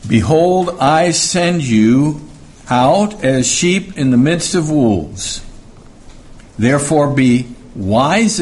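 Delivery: slow, measured reading of the verse; solemn scripture reading voice. Acted. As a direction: read the verse with weight. Reads scripture verse aloud.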